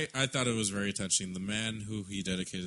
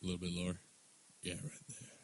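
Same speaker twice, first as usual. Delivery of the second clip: lower, softer, husky tease; sexy low voice; 5 semitones lower, 9 dB quieter than usual. Pretend. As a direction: drop it low and seductive. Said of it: "that's a sexy voice".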